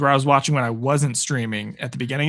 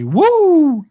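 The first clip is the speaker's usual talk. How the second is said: big high whoop, much louder; cheer; 13 semitones higher, 8 dB louder than usual. Real, not acted. Streamer cheering for viewers' Broncos, whooping loud. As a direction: let out a celebratory yell. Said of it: "I would give you a better yell than that".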